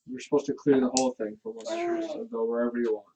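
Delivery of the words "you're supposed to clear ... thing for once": ordinary delivery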